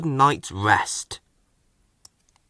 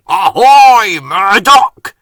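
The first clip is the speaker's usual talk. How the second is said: loud, higher shouted ahoy; 6 semitones higher, 11 dB louder, slower than usual. Acted. Salty hailing the new engine across the yard, loud. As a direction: hail him across the docks. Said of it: "shouted Salty".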